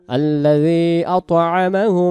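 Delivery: slow, drawn-out chanted tilawat; Quran recitation. Shown as a demonstration. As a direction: recite it slowly with long held vowels. (ধীর তিলাওয়াতের সুরে)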